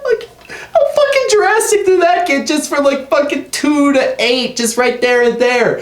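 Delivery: high, disbelieving rant; incredulous. Real, not acted.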